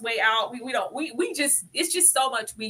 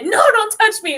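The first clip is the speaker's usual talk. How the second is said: much higher, louder mocking snap; mock Tabitha; 12 semitones higher, 10 dB louder than usual. Mocking Tabitha refusing Julie, loud high imitation. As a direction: snap it like her. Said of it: "she said".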